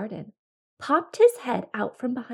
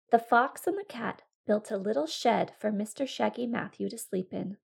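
plain reading voice

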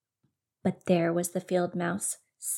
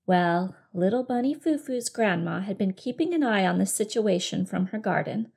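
ordinary delivery

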